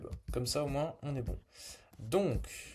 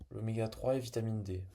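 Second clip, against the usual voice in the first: ordinary delivery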